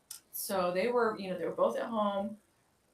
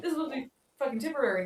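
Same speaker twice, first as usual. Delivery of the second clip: faster, higher, punchy mock insistence; imitating the dentist insisting, higher and quicker; emphatic quote; 6 semitones higher, 4 dB louder, faster than usual. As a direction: hammer the point fast and high. Retold dentist's intent, emphatic.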